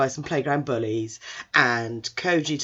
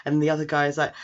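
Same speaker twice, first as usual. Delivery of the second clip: usual speaking voice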